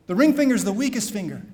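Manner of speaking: ordinary talk, nothing marked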